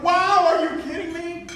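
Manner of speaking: high, excited disbelief; retold thrilled reaction. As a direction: gush as if you can't believe your luck. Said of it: "I was so excited".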